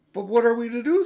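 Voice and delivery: loud, high pleading question; a girl's urgent plea; anxious girl. Acted. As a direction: Susan begging for a plan. Susan asks, much louder and higher.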